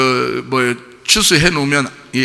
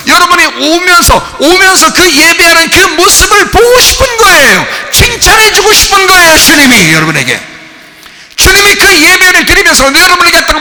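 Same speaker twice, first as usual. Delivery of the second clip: raised, loud sermon voice that keeps building; fervent preaching; 11 semitones higher, 8 dB louder than usual. Acted. Preacher rousing the congregation, loud and fervent. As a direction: preach it out to the whole church.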